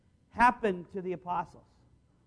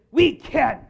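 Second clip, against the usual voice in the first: eager reply; 3 semitones higher, 8 dB louder than usual. Acted. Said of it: "when they said".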